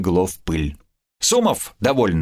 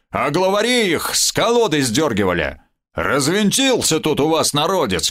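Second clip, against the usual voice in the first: gruff goblin rant; 6 semitones higher than usual. Acted. The goblin boasts to the hostess, in a gruff put-on voice. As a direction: lecture her like a proud brawler. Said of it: "с рыком ощерился гоблин".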